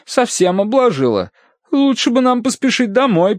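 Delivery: high-pitched fretting; worried. A narrator's reading. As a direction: fret about the coming storm in a high voice. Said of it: "подняла встревоженный взгляд к небу". (обеспокоенно, высоким голосом)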